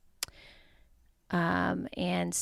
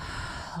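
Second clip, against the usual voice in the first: exhale sigh; 9 dB quieter than usual. Shown as a demonstration. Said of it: "and then exhale".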